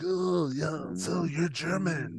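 softly drawn-out actor impression; Marlon Brando. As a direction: imitate a film star, slow and hushed. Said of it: "Marlon Brando comes up, he's like".

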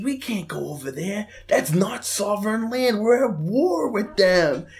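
high, slow put-on panicky voice; mock Warren. Warren warns Frankie they can't go, high anxious mock voice. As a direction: Warren lightly voiced, fretting about going. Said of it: "and he goes".

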